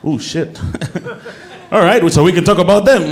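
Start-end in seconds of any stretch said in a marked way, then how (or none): none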